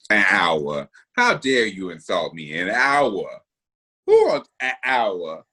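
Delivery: low, affronted disbelief; mock offense. Speaker acts insulted by the co-host's hour limit, mock offense. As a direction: play insulted, dropping the pitch low.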